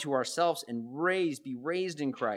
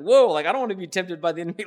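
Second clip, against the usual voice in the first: louder, higher, hurried reaction; playfully overwhelmed, quick and loud; mock alarm; 4 semitones higher, 8 dB louder, much faster than usual. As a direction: blurt it out in light mock alarm. Voices a startled reaction to temptation.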